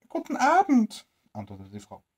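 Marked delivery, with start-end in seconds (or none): 0.1-1.1 high, put-on old woman voice; old woman's voice. Old woman greeting the tramp at her door, high put-on voice. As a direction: answer like a wary old crone, pitched high.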